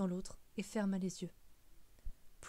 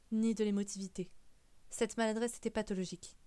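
usual speaking voice

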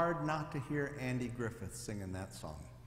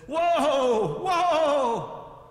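high, loud desperate whoa; panicked whoa; 10 semitones higher, 12 dB louder than usual. A narrator's reading. Preacher yells at the runaway horse in a high, loud panic. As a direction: yell at the horse to stop. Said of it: "The preacher got excited".

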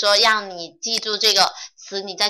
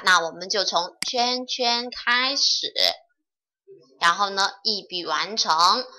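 ordinary talk

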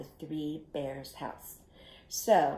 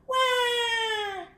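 high-pitched pretend cry; baby bear wail. A narrator's reading. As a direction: wail like a wee little bear. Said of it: "he said in his wee little voice".

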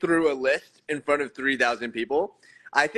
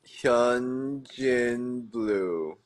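very drawn-out name; 5 semitones lower, much slower than usual. Real, not acted. Drawn out name while searching.

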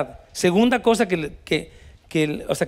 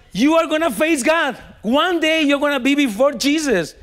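forceful warning; 8 semitones higher, slower than usual. Retold or imitated. Todd warns the cop.

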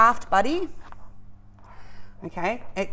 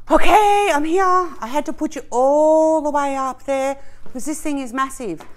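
loud, high call from across the room; calling from afar; 6 semitones higher, 5 dB louder than usual. Real, not acted. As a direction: shout it from a distance. Calling out from far away.

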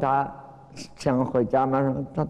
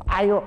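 sudden high yelp of pain, a touch louder; cry of pain; 11 semitones higher, 4 dB louder than usual. Retold or imitated. Baizhang's nose twisted by master Mazu, high pained yelp. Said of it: "他一叫".

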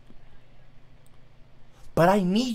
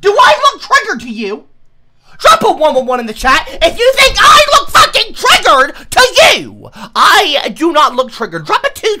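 much higher, louder furious yell; angry shouting; 11 semitones higher, 11 dB louder than usual. Real, not acted. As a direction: yell it at the chat in a rage. Streamer yells at chat.

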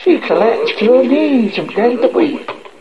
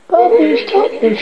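high-pitched scolding, only slightly raised; mild angry shout; 9 semitones higher than usual. A narrator's reading. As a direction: call a child sharply to order. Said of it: "she shouted".

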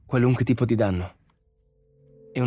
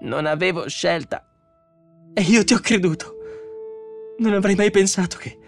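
high, anguished outburst; pleading in fright, pitched far up; terrified; 12 semitones higher, 3 dB louder than usual. A narrator's reading. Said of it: "La voce del robot era terrorizzata".

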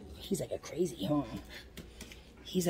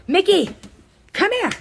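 loud, high call to a pet; calling the dog; 11 semitones higher, 8 dB louder than usual. Real, not acted. Owner summoning Mickey over the noises, high loud call.

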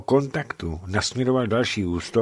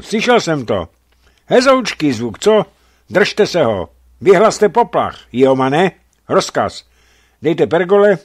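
loud, high captain's orders; commanding orders; 7 semitones higher, 7 dB louder than usual. A narrator's reading. Captain Erikson orders the alarm and recall, loud commands.

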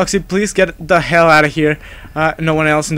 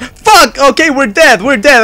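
high, loud alarmed cursing; panicked outburst; 5 semitones higher, 4 dB louder than usual. Real, not acted. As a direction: cry out as if doomed. Reacted too late in game.

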